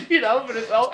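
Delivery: ordinary delivery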